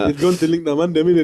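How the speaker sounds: put-on Cardiff Tiger Bay accent, street. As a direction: roadman Cardiff voice. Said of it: "What accent is that?".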